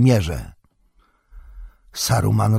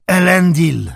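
high, loud rallying call; battle cry; 8 semitones higher, 5 dB louder than usual. A narrator's reading. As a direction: shout it while charging at the breach. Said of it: "zawołał". (głośny okrzyk bojowy)

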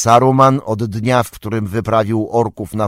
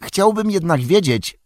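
a hint of a higher young hobbit voice; Pippin, lightly voiced; 9 semitones higher than usual. A narrator's reading. Pippin to Gandalf, lightly voiced regretful hobbit. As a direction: lift into young Pippin, rueful.

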